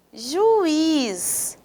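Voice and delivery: JUIZ drawn out, ju-IZ with a held IZ; stressed final syllable. Shown as a demonstration. Teacher quizzing students on stress, JUIZ with long stressed IZ. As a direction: stretch JUIZ into two beats, lingering on IZ. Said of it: "Qual é a sílaba mais forte?".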